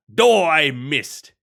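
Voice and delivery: loud, slow mock Wario cry. Telling a friend how Wario shouts when he fails, loud and slow. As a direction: ham up Wario failing. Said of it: "Instead of".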